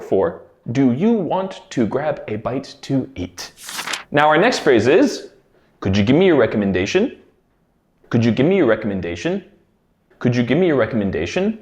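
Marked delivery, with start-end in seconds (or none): 5.8-11.6 native speed. Shown as a demonstration. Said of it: "I'm going to say the words three times".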